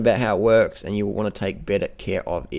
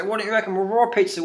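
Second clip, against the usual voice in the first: mock questioner voice; 9 semitones higher, faster than usual. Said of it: "People say".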